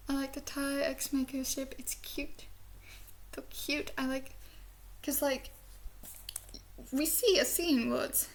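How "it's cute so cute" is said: whisper. Real, not acted. Gushing over a ship.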